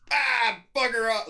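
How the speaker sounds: high, drawn-out mock Irish shout; Irish accent. Mimicking Irish people on TikTok, mock Irish shout. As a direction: toss it off like a cheeky Irish local. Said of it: "the Irish people are like".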